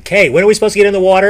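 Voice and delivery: playful group yell. Retold or imitated. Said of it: "we would all scream it at the same time".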